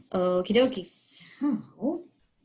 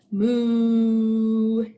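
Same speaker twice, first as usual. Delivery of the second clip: long, louder drawn-out moo; mock moo; 6 dB louder than usual. Shown as a demonstration. Teacher mooing to show the cow. Clue sound for the cow card.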